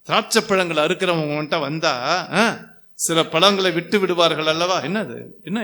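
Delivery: usual speaking voice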